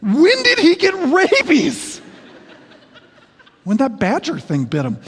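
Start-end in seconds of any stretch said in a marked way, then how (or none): 0.0-2.0 put-on high, slow kid voice, half laughing; confused little kid. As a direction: play the baffled boy, much higher and slower. Retold childhood dialogue with buddy.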